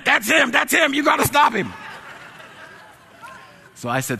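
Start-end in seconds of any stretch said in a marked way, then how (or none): 0.0-1.7 very high, raised urgent voice; hammed-up warning cry; frantic alarm. Clerk's own mind sounding the alarm, frantic high warning.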